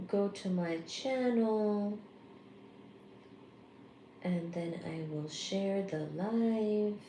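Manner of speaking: soft, slow self-narration while busy; absent murmur. Real, not acted. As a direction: talk yourself through a task, very softly. Murmuring while working the phone.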